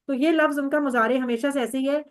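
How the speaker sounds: ordinary talk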